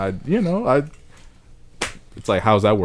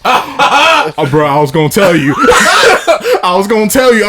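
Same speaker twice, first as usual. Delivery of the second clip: laughing plea; 11 semitones higher, 6 dB louder than usual. Retold or imitated. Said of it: "I'm like".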